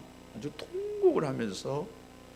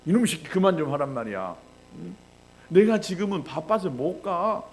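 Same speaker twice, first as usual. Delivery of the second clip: loud, raised-pitch imitation of a fed-up father; angry scolding; 6 semitones higher, 6 dB louder than usual. Busy father berating his pestering child, angry scolding. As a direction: tell the child off at full voice. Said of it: "화가 났어요".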